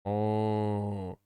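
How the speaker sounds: low, deflated little cow sigh; sad cow. Retold or imitated. The cow answering the rancher, in a low, deflated mock sigh. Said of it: "And the cow is like".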